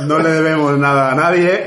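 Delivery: excited declaration. Real, not acted. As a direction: declare it with gusto. Excited boast after laughter. (declaración emocionada, más aguda y fuerte)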